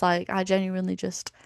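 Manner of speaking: ordinary talk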